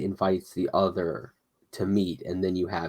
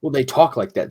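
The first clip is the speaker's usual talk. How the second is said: normal talking voice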